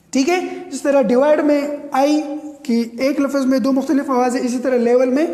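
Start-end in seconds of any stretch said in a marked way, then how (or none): none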